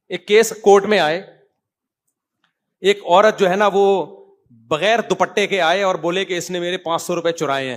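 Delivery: loud impassioned lecture; forceful preaching. Acted. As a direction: hammer the point home to the crowd. Fiery rebuke of cleric's followers.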